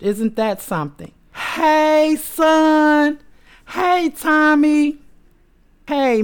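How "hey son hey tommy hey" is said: excited greeting. Real, not acted. Greeting son watching live.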